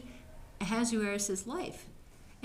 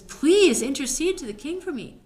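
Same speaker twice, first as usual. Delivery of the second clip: loud, higher-pitched begging; desperate plea; 6 semitones higher, 9 dB louder than usual. Retold or imitated. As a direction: beg for your life, as a storyteller acting it. Doomed Haman begs Esther for mercy.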